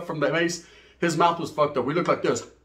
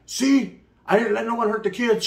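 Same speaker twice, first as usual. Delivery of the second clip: defensive; 6 semitones higher than usual. Retold or imitated. Defending himself to baby's mom.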